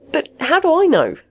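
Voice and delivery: high, loud, helpless appeal; pleading. A narrator's reading. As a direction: beg for a reason to believe. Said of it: "Magnusson pleaded".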